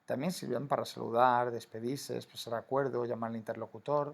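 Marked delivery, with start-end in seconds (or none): none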